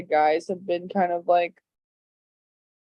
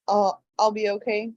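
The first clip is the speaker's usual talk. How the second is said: normal talking voice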